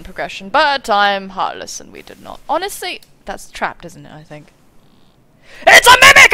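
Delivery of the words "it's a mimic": high, loud scream of alarm; loud, high scream at the monster chest; shriek of surprise. Real, not acted. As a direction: shriek it as the trap springs.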